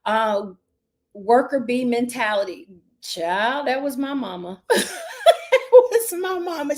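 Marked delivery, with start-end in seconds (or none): 3.7-6.8 real laugh breaking through, drawn out. Real, not acted. Coach recalling her mama, laughing through the words.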